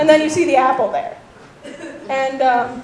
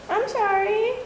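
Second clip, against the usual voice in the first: higher, softer retold apology; mock meek apology; 7 semitones higher, 5 dB quieter, slower than usual. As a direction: apologize meekly in a put-on voice. Boy apologizes after being yelled at.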